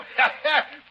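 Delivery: high, loud, gloating cackle; villain's cackle. Acted. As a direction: cackle like a pirate who has won. Pirate laughing at his threat.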